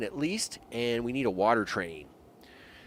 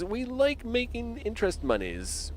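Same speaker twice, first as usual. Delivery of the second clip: usual speaking voice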